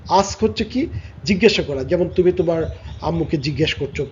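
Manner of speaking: ordinary talk, nothing marked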